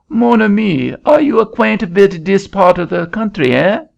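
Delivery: put-on high, lilting French-accented Frenchman calling out; a Frenchman hailing a farmer in a French accent. Frenchman calling to a farmer, high French accent.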